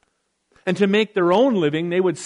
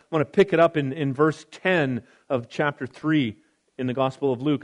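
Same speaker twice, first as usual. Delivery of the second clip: normal talking voice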